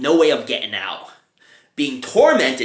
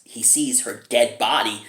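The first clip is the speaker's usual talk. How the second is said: ordinary talk, nothing marked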